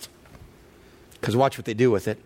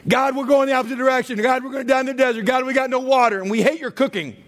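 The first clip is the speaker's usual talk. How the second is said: higher, fast, hammed-up whining; whining Israelites; 7 semitones higher, faster than usual. Israelites grumbling at God in the desert, mock whine. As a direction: rattle off the gripes like a nagging crowd. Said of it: "They throw a new wrinkle in here".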